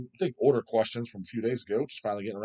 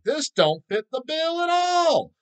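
mock disbelief; 14 semitones higher, 4 dB louder, slower than usual. Disgusted reaction to clean vocals.

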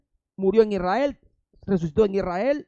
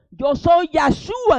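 slow, loud divine pronouncement, higher; voice of the Lord; 8 semitones higher, 5 dB louder, much slower than usual. Acted. The voice from heaven naming itself to Saul, slow and loud. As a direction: proclaim each word slowly from above. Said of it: "Oiga lo que le dijo la voz".

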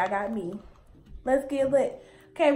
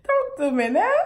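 catchphrase; 5 semitones higher, 6 dB louder than usual. Real, not acted. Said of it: "Why do I always say that?".

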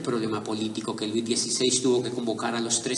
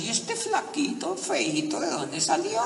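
high, mocking children's whisper-voice; a light mock of the children's scorn; mocking kids' voice; 11 semitones higher, 4 dB quieter than usual. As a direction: sneer like a bunch of skeptical kids. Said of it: "como diciendo".

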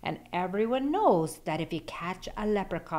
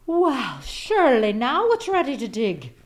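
loud, higher proud boast; 5 semitones higher, 7 dB louder than usual. A narrator's reading. Jamie proudly announcing the potato is ready, loud. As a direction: puff up over the giant potato. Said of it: "Jamie said proudly".

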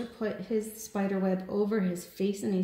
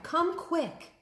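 urgent call; 10 semitones higher than usual. A narrator's reading. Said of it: "he cried".

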